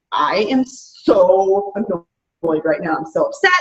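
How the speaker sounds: low, slow mock whine of a fed-up person; playful imitation of someone annoyed and upset; grumpy complaining. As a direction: drag it out like you're sulking at your desk. Mocking a frustrated person.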